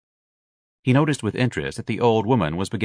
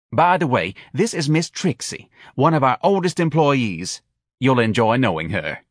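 higher, chirpy introduction; eager office manager; 5 semitones higher, 3 dB louder than usual. A narrator's reading. Manager introducing applicant to oldest employee, eager. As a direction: introduce her eagerly, pitched up.

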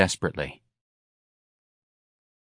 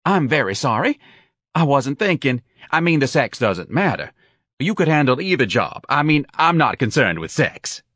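flustered, higher-pitched apologizing; great confusion; 6 semitones higher, 3 dB louder than usual. A narrator's reading. Office manager apologizing to offended applicant, confused. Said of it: "said in great confusion".

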